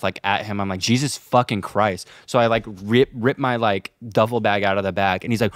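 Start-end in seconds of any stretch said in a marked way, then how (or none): none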